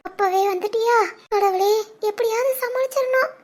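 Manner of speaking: hushed, anxious aside; soft private panic; worried inner thought. Acted. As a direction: pray under your breath for an escape. Caught daughter's worried inner thought.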